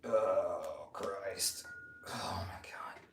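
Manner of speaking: soft, breath-heavy groaned exclamations; straining in a stretch. Real, not acted. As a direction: groan it out quietly mid-stretch.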